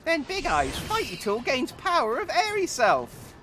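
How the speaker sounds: very high, loud squeaky goblin. Acted. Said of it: "attempt my goblin voice".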